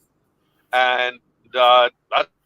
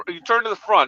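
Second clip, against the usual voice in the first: ordinary talk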